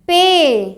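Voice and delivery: "पे" held long on the ay; lengthened ay. Shown as a demonstration. Teacher showing how ai lengthens the vowel, "पे" drawn out. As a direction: draw out "पे" on one long vowel. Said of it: "कुछ लंबा सा स्व".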